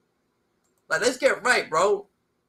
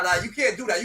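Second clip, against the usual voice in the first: ordinary talk, nothing marked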